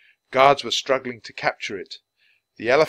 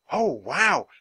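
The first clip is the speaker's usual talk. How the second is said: excited; 9 semitones higher, 3 dB louder than usual. A narrator's reading. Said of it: "her eyes shining".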